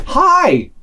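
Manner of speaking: high, cheery greeting; happy Norwegian girl. Acted. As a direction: mimic a beaming, overfriendly girl. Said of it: "big smile, like, happy".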